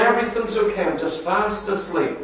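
soft, lazy mock voice of a sleepy believer; complacent sleeper. Preacher mocks a complacent believer, soft and drowsy.

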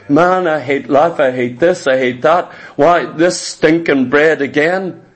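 low-pitched imitation of a complainer; grumbling. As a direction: gripe like someone sick of it all. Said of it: "what you grumble about".

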